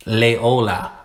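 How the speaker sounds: "Loyola" as lay-OH-la; easier pronunciation. Shown as a demonstration. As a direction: start "Loyola" with a clear "lay". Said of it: "I would just say Lay".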